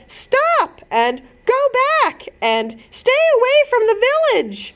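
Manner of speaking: high shouted warnings; yelling heroes. A narrator's reading. Heroes shout at the giant to stay away, high yells. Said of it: "They yelled".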